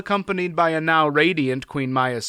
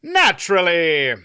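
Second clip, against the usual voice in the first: offhand; 5 semitones higher, 6 dB louder than usual. A narrator's reading. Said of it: "replied in a rather offhand way".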